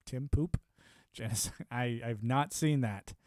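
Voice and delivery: ordinary delivery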